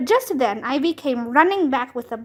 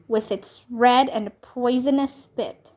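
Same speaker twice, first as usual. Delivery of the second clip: usual speaking voice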